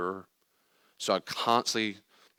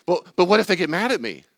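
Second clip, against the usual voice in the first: high, loud fretful worrying; anxious objector; 10 semitones higher, 10 dB louder than usual. Acted. Anxious person fearing others' anger, high loud fretting. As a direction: worry aloud in a hammed-up high voice.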